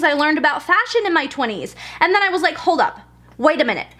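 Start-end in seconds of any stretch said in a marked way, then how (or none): none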